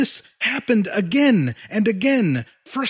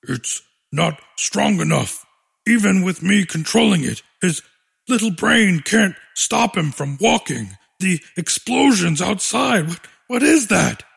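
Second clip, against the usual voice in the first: put-on little monster boy voice. Dexy voiced, lower.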